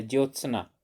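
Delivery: ज्योत्सना with half त and half स, ज्योत-सना; careful correct spelling. Shown as a demonstration. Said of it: "यानी ता भी आधा, सा भी आधा".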